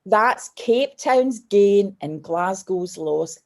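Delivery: very slow, each word weighed; slow quoted line. Retold or imitated. Airport man consoling the tearful girl, spoken very slowly. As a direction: deliver the kind man's verdict slowly and deliberately. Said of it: "And he went".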